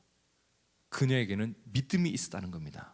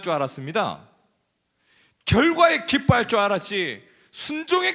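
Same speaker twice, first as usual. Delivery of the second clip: much higher, louder preaching climax; full-force preaching; 9 semitones higher, 7 dB louder than usual. Acted. Preacher exhorting the congregation, high and loud. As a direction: drive the point home to the congregation.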